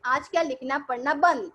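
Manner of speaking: ordinary talk, nothing marked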